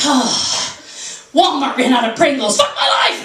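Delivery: overblown outrage. Acted. Shopper lamenting a trivial shortage, overblown outrage. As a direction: wail the last phrase as if disaster struck. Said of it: "It's always like".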